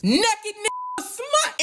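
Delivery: high, slow outraged cry; scandalized high outburst. Real, not acted. Outraged reveal in clip.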